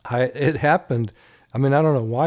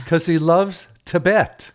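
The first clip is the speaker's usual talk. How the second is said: slow punchline; 4 semitones higher, 4 dB louder, slower than usual. Acted. Joke punchline delivered.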